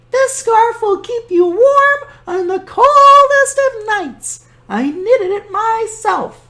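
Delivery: put-on high, sweet old woman; cheerful high granny voice; old grandmother voice. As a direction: play the kindly old granny talking to her guest. Baba Brena voice.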